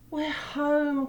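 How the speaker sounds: high, loud happy call; joyful cry. A narrator's reading. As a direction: call out glad to be home. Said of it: "cried Douglas".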